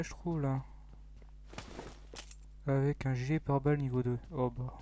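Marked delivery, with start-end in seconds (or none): none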